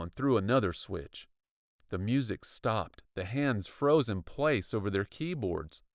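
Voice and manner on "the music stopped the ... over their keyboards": ordinary delivery, nothing marked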